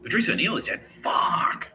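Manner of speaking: drawn-out, voiceless mock scream; mock psychic scream. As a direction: scream it under the breath. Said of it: "hearing the psychic screams".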